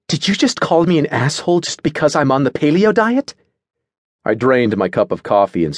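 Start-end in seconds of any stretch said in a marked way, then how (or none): 0.1-3.3 high-pitched, affronted accusation rising at the end; mutinous. A narrator's reading. Natasha confronts Edric over the insult, affronted. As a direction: challenge the insult, mildly outraged. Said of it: "muttered mutinously".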